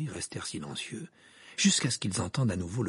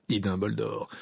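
ordinary delivery, nothing marked

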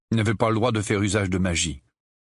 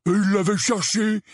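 high, drawn-out angry justification; growled; 13 semitones higher, 4 dB louder than usual. A narrator's reading. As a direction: protest angrily, pitched way up. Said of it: "gronda Dudley". (grondement de colère, aigu et traînant)

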